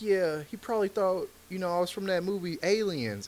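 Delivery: nervous. Retold or imitated. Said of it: "didn't know anything about comedy".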